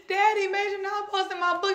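high, whiny mock sobbing to a parent; hammed-up tearful whine; imitated crying. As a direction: re-enact tearfully telling your dad. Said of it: "I'm crying".

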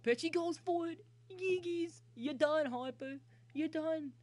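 high, loud victory yell; triumphant shouting. Real, not acted. Gamer telling Harper he's done after strikeout, triumphant high shout.

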